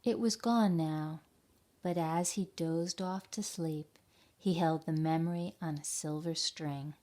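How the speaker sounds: gentle storybook reading voice; story narration. A narrator's reading. Teacher reading a picture book to children, gentle narration. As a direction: read it like a bedtime tale to kids.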